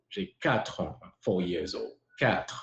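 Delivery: ordinary talk, nothing marked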